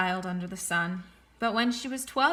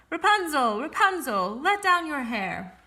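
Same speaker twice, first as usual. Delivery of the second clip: louder, slow call up the tower; prince calling up; 5 semitones higher, 5 dB louder than usual. A narrator's reading. Prince imitating the sorceress's call at the tower, loud. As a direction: copy the witch's call. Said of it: "called out".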